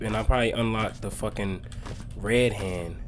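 usual speaking voice

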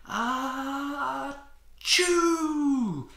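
a giant high squealing sneeze, loud at the end; huge sneeze. Acted. Octo erupting in a giant allergic sneeze.

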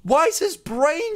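high-pitched startled outburst; gasp, then squeal out the questions; shocked disbelief. Real, not acted. Startled by exposed brain.

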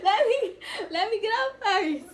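high-pitched, giggly protest; loud, high plea breaking into laughs; laughing plea. Real, not acted. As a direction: beg through laughter while being hit. Pleading while being hit.

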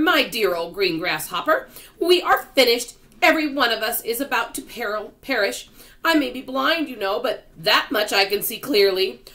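loud, high, emphatic protest; despairing cry. A narrator's reading. Earthworm tells the grasshopper they are finished, despairing cry. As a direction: declare doom at full voice. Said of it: "cried the earthworm".

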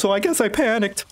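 high, stammering henchman apologizing to the boss; flustered apologetic underling. Acted. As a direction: plead nervously, tripping over the words. GM plays flustered Cyril apologizing.

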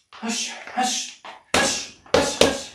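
sharp whispered hush with each punch; hush exhales. Shown as a demonstration. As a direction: breathe out hard on every hush. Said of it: "Letting those hush, hush punches snap out".

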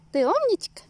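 squeaky praising a dog. Real, not acted.

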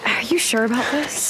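nervous whispered doubt. Acted. Whispering doubt about the risky plan. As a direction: whisper your worry.

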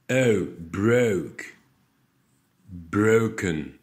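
"O", then "broke", "broken" with a long oh glide; diphthong drill. Shown as a demonstration. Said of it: "les belles diphtongues".

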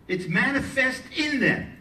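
"in" punched high in "in them"; stress on IN. Real, not acted. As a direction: drive home the word "in". Contrasts "in" with "to.

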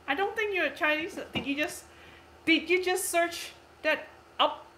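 normal talking voice

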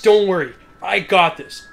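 mock heroic.

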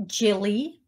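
Jilly with a soft J sound. Shown as a demonstration. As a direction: say "Jilly" starting like "jam". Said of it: "Gilly or Jilly".

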